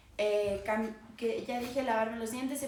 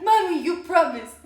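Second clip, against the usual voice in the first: put-on much louder, pleading kid voice; imitating her son; 6 semitones higher, 12 dB louder than usual. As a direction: whine it like a child holding mom to a promise. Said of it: "He's like".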